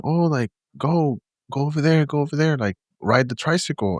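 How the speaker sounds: lightly voiced kid urging a friend along; imitated little boy. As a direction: play a small boy directing his playmate. Nephew calling to invisible friend.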